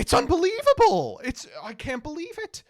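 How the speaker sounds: sudden high, loud cry of disbelief; stunned disbelief. Real, not acted. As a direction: blurt it out high and loud, floored. Stunned reaction to the clip.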